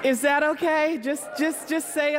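preacher coaxing the congregation to answer back. Shown as a demonstration. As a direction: ask again, drawing the crowd out. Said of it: "repeated to the congregation".

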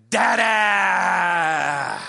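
loud, long-drawn happy shout; gleeful toddler. Retold or imitated. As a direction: imitate a two-year-old shouting with delight, stretching it out. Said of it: "huge two-year-old smile on his face".

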